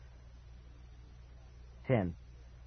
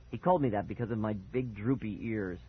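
ordinary delivery, nothing marked

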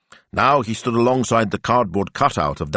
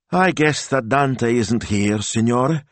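a touch of sadness; despondent; 6 semitones higher, slower than usual. A narrator's reading. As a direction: mildly let down, slow. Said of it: "she said, despondent".

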